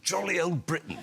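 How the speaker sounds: high-pitched royal catchphrase; imitating Prince Charles. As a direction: mimic the royal to get into character. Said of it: "I'd just go".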